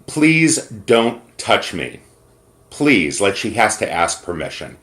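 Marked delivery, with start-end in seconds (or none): 0.1-3.2 slow, pleading mock plea; a hint of a woman begging to be left alone; mimicked plea. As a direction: drag out a frightened request. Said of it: "she turns around and says".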